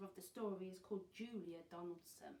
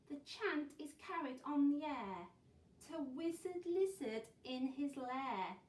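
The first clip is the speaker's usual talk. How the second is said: raised, emphatic rhyming narration; animated story reading; 6 semitones higher, 8 dB louder than usual. A narrator's reading. Teacher reads of Wizard Lizard to children, dramatic storytelling. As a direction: project the verse with drama.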